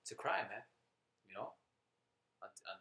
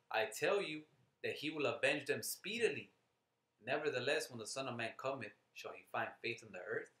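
scripture reading; 4 dB louder than usual. Acted. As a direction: proclaim the promise from scripture, then ease off. Reads scripture aloud to listeners.

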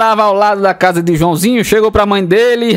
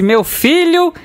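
teacher voice; 6 semitones higher, much slower than usual. Acted.

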